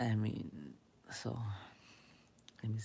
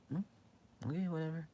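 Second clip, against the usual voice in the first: higher, breezy dismissive hum and brush-off; dismissive shrug; 7 semitones higher than usual. Retold or imitated. Walking away from an argument, breezy dismissive tone. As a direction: brush the other person off lightly.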